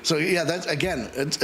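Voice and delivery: ordinary talk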